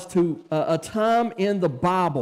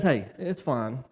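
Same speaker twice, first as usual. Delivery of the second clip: calm reassurance; 7 semitones lower, 5 dB quieter than usual. Retold or imitated. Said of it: "So Jesus says".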